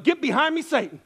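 quick, loud mock rebuke; high-pitched, playful outburst; joking rebuke. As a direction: fend off temptation with comic force. Joking rebuke to ice cream.

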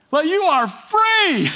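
a touch of triumph; elated, stretched announcement. Pretend. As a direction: proclaim freedom with delight. Preacher voicing the release.

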